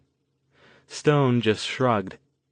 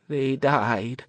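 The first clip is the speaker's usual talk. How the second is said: put-on higher, trembling old woman's voice; quavering; 4 semitones higher than usual. A narrator's reading. Old woman telling of the deaths, quavering. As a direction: let the voice wobble with dread. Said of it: "the old woman quavered".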